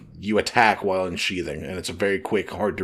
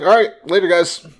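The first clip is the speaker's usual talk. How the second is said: breezy goodbye; 7 semitones higher, 5 dB louder than usual. Pretend. Breezy goodbye voiced by a character.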